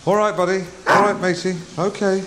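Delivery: talking to dog. Real, not acted. Partridge greeting his dog Seldom in a high coaxing voice. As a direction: coo at the dog like a pet owner.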